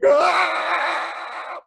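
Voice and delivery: long, high, loud mock scream; fleeing scream. A narrator's reading. Storyteller playing people fleeing, long panicky scream. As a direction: scream like a crowd running away. Townspeople run away screaming.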